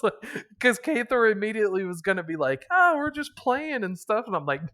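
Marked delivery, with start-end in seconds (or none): 2.7-4.2 fake innocent. Cathor fakes innocence.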